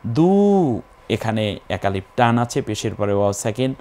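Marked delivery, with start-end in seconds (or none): 0.1-0.8 দু with a held long উ, higher; one-alif stretch. Shown as a demonstration. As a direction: draw out the vowel of দু. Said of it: "এক আলিফ টেনে বলতে হবে".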